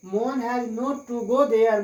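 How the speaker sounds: very slow, each word spelled out for students; slow dictation. Shown as a demonstration. Teacher dictating the English answer to students, very slowly. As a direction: say it slowly so students can write. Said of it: "teacher dictates answer".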